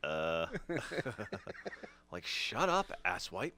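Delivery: low grunt, chuckle, then a loud dopey insult; Butthead. Acted. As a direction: dim cartoon slacker telling someone off. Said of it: "you're doing the, the Butthead".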